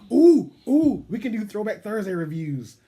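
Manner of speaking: high, loud, eager outburst; excited. Real, not acted. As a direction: burst out with a new idea. Host gets a sudden idea.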